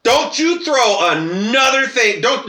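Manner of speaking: a student yelling at the teacher after being hit; retold yell. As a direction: yell the warning back at the teacher. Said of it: "And I yell out".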